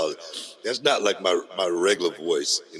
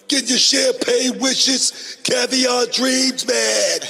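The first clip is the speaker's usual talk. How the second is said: way higher, slow and louder, stretched out; high hype drawl; 5 dB louder, much slower than usual. Acted. Host opening the show, high slow hype call. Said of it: "sometimes my octave go up".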